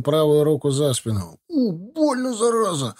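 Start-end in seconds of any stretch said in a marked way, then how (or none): 1.5-3.0 high yelp of pain; hit hard crying out. A narrator's reading. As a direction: cry out as the arm is twisted. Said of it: "взвыл от боли Тимофей".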